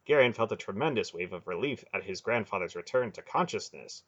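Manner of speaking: ordinary delivery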